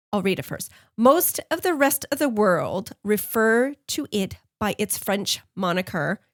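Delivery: ordinary talk, nothing marked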